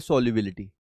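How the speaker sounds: Solubility said low and soft, falling; soft model answer. Shown as a demonstration. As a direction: prompt solubility quietly. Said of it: "इसको हम लोग क्या बोलते हैं?".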